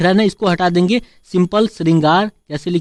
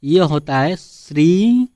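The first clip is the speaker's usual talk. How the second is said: very slow, each syllable spaced; slow demonstration; 2 semitones lower, much slower than usual. Shown as a demonstration. Teacher stressing the correct Shri to students, slow and deliberate. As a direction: lay out Shri slowly for the class. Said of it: "ध्यान से देखिएगा".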